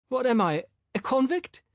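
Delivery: high, indignant surprised question. A narrator's reading. The man taken aback at being told off, surprised. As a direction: rise sharply in offended surprise. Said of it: "asked in surprise".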